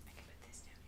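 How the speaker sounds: soft whisper to self; whispered aside. Real, not acted. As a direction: murmur it as you place the piece. Whispering to herself while crafting.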